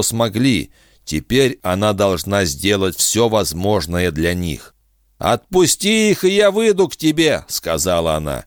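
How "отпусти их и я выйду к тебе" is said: high, otherworldly alien woman. Acted. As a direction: brave alien offering herself. Defin's alien voice held.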